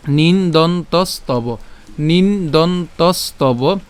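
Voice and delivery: slow, separated "নিন দন তস তব"; syllable by syllable. Shown as a demonstration. Teacher adding the next piece, slow segmented "নিন দন তস তব". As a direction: dwell on each piece of নিন দন তস তব. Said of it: "এরপর কি থাকে".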